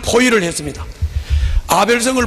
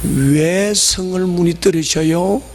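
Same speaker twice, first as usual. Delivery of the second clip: slow, drawn-out dainty question; mock ladylike; much slower than usual. Shown as a demonstration. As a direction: ask it sweetly slow, parodying ladylike politeness. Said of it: "여자답게". (느릿하게 여자다운 척하는 말투)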